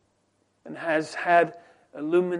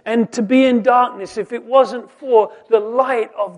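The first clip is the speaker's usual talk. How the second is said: raised, higher voice, pressing each question; preaching at full force; 6 semitones higher, 9 dB louder than usual. Acted. Preacher to his congregation on man's blindness, full-force preaching. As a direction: preach it out, rising with every question.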